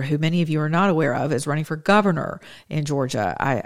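normal talking voice

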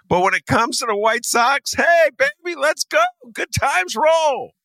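very high, gleeful whoop; mock party cheer. Mocks city's eagerness for Sox.

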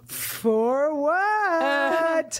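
high drawn-out puzzled question. Retold or imitated. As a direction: stretch it out, baffled. Her puzzled reply to bellhop.

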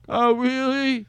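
high, teasing oh really; mock surprise. Host teasing guest with a high mock oh really. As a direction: echo the fake amazement.

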